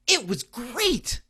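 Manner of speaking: high, slow and loud, really thrilled; enthusiastic. Retold or imitated. Son answers enthusiastically.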